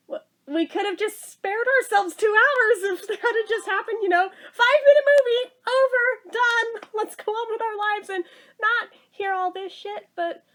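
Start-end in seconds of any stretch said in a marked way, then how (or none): 0.1-4.3 laughing while talking. Real, not acted. Laughing through the rant. 4.5-8.9 much higher and louder, quick and giddy; laughing glee at the idea; gleeful outburst. Real, not acted. Gleeful rant about the movie.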